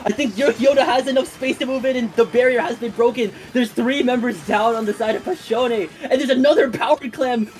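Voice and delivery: high-pitched live esports call; rising game commentary held at a high pitch; excited play-by-play. Real, not acted. Live play-by-play of a big push.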